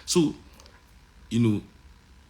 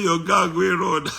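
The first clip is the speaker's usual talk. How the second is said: mimicking his wife; 5 semitones higher, 3 dB louder, slower than usual. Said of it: "my wife say".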